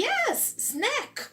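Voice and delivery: a waiter happily insisting; eager waiter. Retold or imitated. Waiter cheerfully confirming the dish to the friend, high and bright. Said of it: "E ele".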